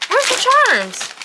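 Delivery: high, slow sing-song call; excited squeal. Real, not acted. Looking for charms, high excited call. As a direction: sing it out, hunting eagerly.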